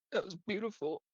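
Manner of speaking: high, lightly voiced little halfling; a touched little halfling, moved; tearful halfling. Halfling wiping a tear.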